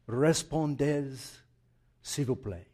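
slow, showy French accent; French phrase pronounced slowly and carefully. Shown as a demonstration. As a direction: put on your best French accent. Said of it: "but the accent was good".

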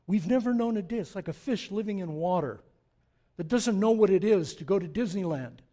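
loud, high-pitched pulpit preaching; full-voiced sermon climax; preaching at full force. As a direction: drive it home to the congregation.

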